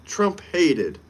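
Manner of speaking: ordinary talk, nothing marked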